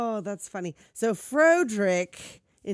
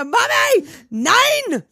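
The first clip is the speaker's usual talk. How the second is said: high frantic screaming; panicked scream; 12 dB louder, much slower than usual. Retold or imitated. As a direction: shriek it in panic. Doctor screaming in panic, quoted.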